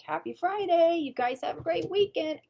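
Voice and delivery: bright sing-song goodbye; cheerful imitation. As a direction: mimic a man in a great mood. Grumpy man now cheerful.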